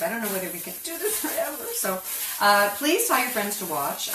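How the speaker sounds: normal talking voice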